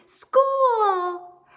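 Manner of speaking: high, loud cry of joy; excited cheer. A narrator's reading. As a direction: burst out happily. Excited girl running to school.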